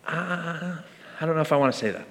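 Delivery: low, soft hesitant mumble; reluctant thought. Acted. Churchgoer let down in the past, asked to say it, hesitant and low. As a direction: trail in quietly on a doubtful "uh". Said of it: "you're thinking".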